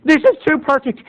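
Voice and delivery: high thrilled little gasp; delighted kid. Retold or imitated. Young boy thrilled to watch rated R movies. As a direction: savor it like a kid getting away with something. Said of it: "So I was like".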